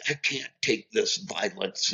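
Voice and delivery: soft, put-on voice starting high; mock indignation in a small, altered voice; imitating upset relative. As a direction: mimic a woman walking out in protest. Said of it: "Ann goes".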